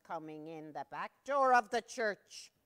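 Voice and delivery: loud, dramatic build-up; dramatic storyteller boom; suspense. A narrator's reading. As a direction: announce it like a big reveal.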